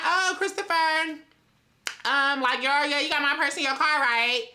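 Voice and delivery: playing dumb and sweet on the phone; sweet innocent act. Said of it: "So I'm like".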